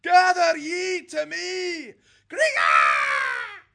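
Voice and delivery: loud, slow, high falcon cry; calling the clan across the night sky; screeching call. Acted. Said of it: "screeching at intervals".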